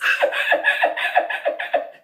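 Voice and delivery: high, loud breathless laugh; high wheezing laugh. Real, not acted. Loud high laugh at grandpa's reaction. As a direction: laugh until the air runs out.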